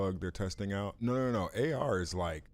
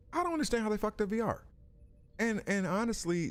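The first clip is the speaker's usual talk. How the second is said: higher, fast, exasperated "I don't understand"; exasperated disbelief; 14 semitones higher than usual. Real, not acted. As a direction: blurt it out in disbelief, fast and high.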